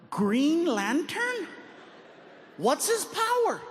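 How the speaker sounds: high, slow incredulous "Green Lantern?"; mock disbelief, stretched out. Comedian scoffing at Fabian's pick, high and slow. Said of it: "I said".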